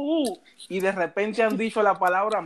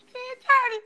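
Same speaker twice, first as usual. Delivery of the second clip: much higher, girlish put-on voice; mimicking a girl's voice. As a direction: squeak it out like the girl he liked. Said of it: "escucho una voz que me dice".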